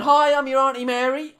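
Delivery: high, loud hard-of-hearing auntie; imitated shouting. Hard-of-hearing great-aunt greeting her relative at the busy tills, shouting. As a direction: shout it like she can't hear herself. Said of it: "she's shouting 'cause she's got really bad hearing".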